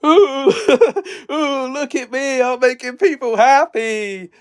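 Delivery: hammed-up fake happiness, pitched high; sarcastic mock glee. Shown as a demonstration. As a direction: gush like a giddy fool to show sarcasm. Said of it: "I just had to demonstrate that".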